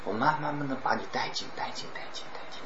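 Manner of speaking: quiet, slow, drawing the listener in; a hushed build-up. Shown as a demonstration. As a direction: lure them in softly, almost whispering. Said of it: "我刚才也用的一个语境".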